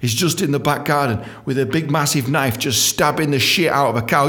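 ordinary talk

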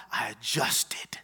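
slow whispered confession. Real, not acted. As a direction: drop to a slow soft whisper. Confessional hush in the sermon.

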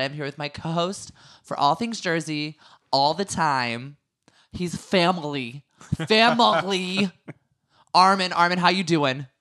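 normal talking voice

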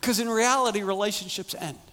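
loud, high preacher voice; full-force preaching. Acted. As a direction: drive the point home.

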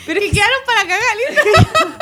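high laughing voice. Real, not acted. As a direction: squeal it laughing. Laughing at mistake.

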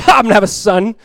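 loud, incredulous scoff; mocking. Sarah mocking God's promise of a son, mocking. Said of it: "Like mocking God".

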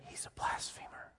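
hushed whisper, voice dropped right down; whispered accusation. Retold or imitated. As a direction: church folk whispering an accusation about the Messiah, whispering. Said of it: "and they went".

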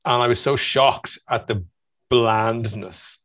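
usual speaking voice

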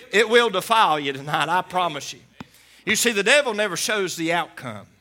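loud, high, fervent preacher's cry; full-force preaching. Acted. Preacher exhorting the congregation, loud and fervent.

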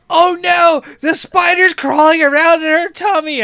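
put-on high, loud, upset little girl; worried kid. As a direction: fret like a frightened child. Worried older daughter quoted.

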